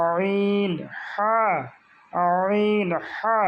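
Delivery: "আইন, হা" as if the throat were being choked; squeezed throat sounds. Shown as a demonstration. Teacher likens it to a choked throat, strained "আইন, হা". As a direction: press "আইন" and "হা" out through a tight throat. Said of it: "গলায় টিপে ধরলে যেরকম শব্দ হয়".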